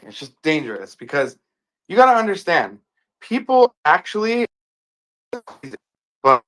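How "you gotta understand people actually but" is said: glitchy robotic audio; choppy metallic connection. Real, not acted. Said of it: "you sound robotic".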